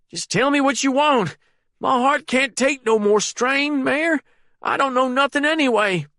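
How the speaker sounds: put-on high, frightened pleading old man voice. A narrator's reading. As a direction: beg weakly, heart straining. Terrified prisoner begs.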